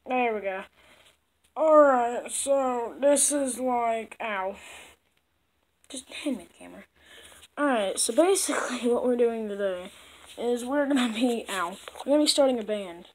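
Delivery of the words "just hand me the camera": whispering. Acted. Aside off the vlog.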